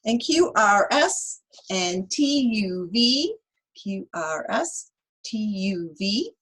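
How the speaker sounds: put-on lively read-aloud for kids; reading to children. Teacher reading Chicka Chicka Boom Boom to children, lively. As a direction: read the rhyme to the class with bounce.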